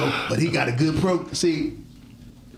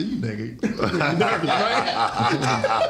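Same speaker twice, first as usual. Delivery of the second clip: teasing laugh; 4 semitones lower, much slower than usual. Retold or imitated. Teasing a friend about nerves.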